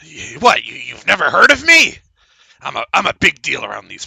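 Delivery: mock-affronted halfling; hammed-up indignation; offended surprise. Halfling offended at not being known.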